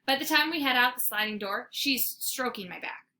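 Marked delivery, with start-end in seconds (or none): none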